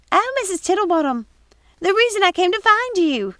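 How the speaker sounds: high, loud flustered maid voice; alarmed young housekeeper pleading; high excited servant's voice; Missus Pickle, urgent. Acted.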